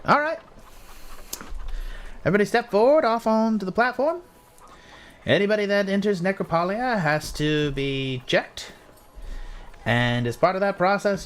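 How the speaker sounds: high-pitched guard giving instructions; guard running through procedure, pitched well up; officious guard. Acted. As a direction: call the newcomers forward in a high character voice. Game master plays the guard.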